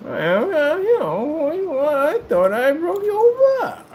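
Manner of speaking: high, slow, loud masked-villain parody; Bane. Retold or imitated. Imitating the cereal bowl dude, high slow voice. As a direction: drawl it way up high, words sliding. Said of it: "then the cereal bowl dude".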